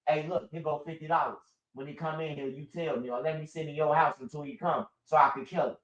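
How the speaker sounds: quietly bribing the neighbor to tip him off; hushed conspiring. Retold or imitated. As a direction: murmur the setup so nobody hears. Neighbor bribed for setup.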